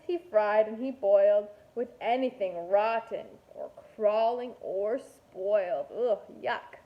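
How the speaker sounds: ordinary delivery, nothing marked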